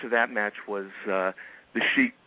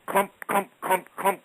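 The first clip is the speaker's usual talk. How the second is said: raised, mimicked thudding steps; imitated footsteps; 10 semitones higher, slower than usual. Mimicking the footsteps he heard on his back steps at night. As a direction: sound out the clumping on the stairs. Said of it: "I hear".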